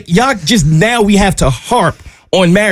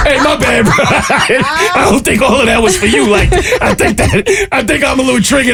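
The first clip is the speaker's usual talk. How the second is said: laughing apology; 6 semitones higher than usual. Retold or imitated.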